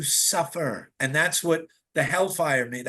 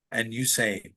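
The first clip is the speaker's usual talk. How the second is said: usual speaking voice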